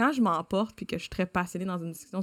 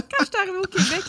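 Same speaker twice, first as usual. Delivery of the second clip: laughing; 13 semitones higher, 6 dB louder, faster than usual. Real, not acted. Still laughing at her own story.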